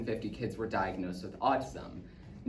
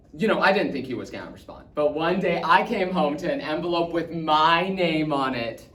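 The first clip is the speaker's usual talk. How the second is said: loud, higher, thrilled telling; excited boy; 4 semitones higher, 7 dB louder than usual. Acted. Boy telling of Stephen Hawking's reply, excited. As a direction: build with excitement to the big news.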